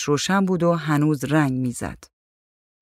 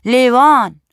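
loud, high one-word call; amused call; 9 semitones higher, 8 dB louder, slower than usual. A narrator's reading. Painter answers from outside the room, loud amused call. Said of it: "نقاش از بیرون خندید و گفت".

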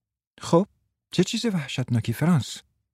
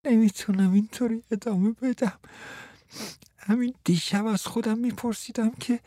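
higher, whiny, self-pitying voice; whining with a lump in throat; 8 semitones higher than usual. Acted. Frans moaning to Martha, higher and whiny. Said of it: "با بغض و ناله گفت".

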